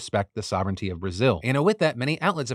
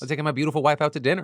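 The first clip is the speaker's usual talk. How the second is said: rushed aside; 4 semitones higher, faster than usual. Acted. Quick joke aside in ad.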